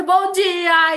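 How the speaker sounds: high, loud, bright-eyed hello; cheery greeting. Retold or imitated. The warm person entering the elevator, loud cheery greeting. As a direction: burst in with a big good morning.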